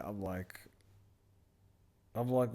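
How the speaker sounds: normal talking voice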